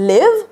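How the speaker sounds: "live" with a short i; short-vowel live. Shown as a demonstration. Teacher contrasts it with leave, short-i "live". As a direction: keep the vowel of "live" brief, ending on v. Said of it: "different उच्चार होतात".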